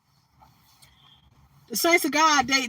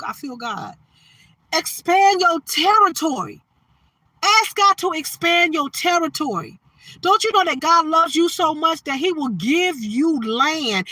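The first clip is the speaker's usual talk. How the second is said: preaching; 3 semitones higher, 4 dB louder than usual. Real, not acted. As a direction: preach it out, stretching every word to the congregation. Preaching at full force.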